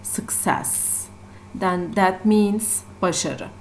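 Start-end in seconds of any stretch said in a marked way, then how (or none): 0.0-1.1 "success" softly, with the weight on "cess"; stress on second syllable. Shown as a demonstration. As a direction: lean on the final "cess" of "success", low and soft. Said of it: "the second syllable".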